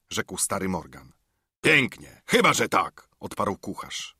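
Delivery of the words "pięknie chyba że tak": mocking retort. Silver retorting to Morgan.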